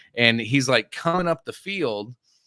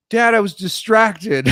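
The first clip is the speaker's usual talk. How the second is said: slow, sing-song whine, higher and louder; sheepish kid; 9 semitones higher, 7 dB louder, slower than usual. Retold or imitated. Son excusing himself to dad after crashing, slow sing-song whine. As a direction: drag it out like a caught kid. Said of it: "he goes".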